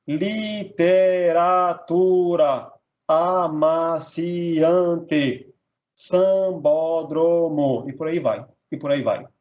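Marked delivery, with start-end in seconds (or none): 0.1-7.9 very slow, each syllable of the word stretched out. Shown as a demonstration. Teacher showing polysyllables to students, syllable by syllable. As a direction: draw every syllable out separately. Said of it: "de polissílaba".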